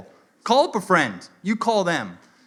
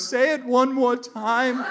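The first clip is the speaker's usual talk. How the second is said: slow, high, strained plea; mock existential crisis; 9 semitones higher, much slower than usual. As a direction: imitate a shaken Frenchman begging for a repeat. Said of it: "Dude is having an existential crisis".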